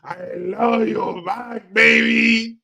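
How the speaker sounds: ordinary delivery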